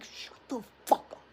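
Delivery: mock-angry whispered order; voiceless, seething imitation; retold furious hiss. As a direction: hiss it through imitated fury, no voice. Mother silencing father, voiceless hissed retelling.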